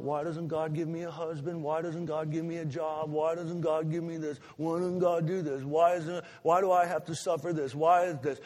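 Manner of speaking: mock pity-party whine; pouty whine. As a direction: sulk like a spoiled child, building to a whine. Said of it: "we just sulk and we just pout".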